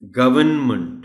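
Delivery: गवर्नमेंट as a loud "GUV-un-munt"; correct, silent r. Shown as a demonstration. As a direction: say गवर्नमेंट keeping n, dropping r. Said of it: "नहीं है आर". (گورنمنٹ بغیر r، n کے ساتھ)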